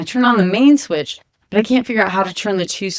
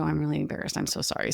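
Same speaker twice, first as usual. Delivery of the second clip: near-whispered, much softer apology; embarrassed; 4 semitones lower, 13 dB quieter, faster than usual. Retold or imitated. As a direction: shrink away, mortified and hushed. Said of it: "so embarrassed".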